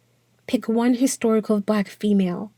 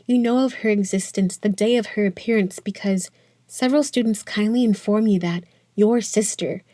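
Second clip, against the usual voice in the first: ordinary talk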